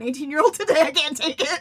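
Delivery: loud protest climbing into a high wail; mock exasperation. Author recalls pleading with teen reader, mock exasperation. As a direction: build to a hammed-up overwhelmed cry.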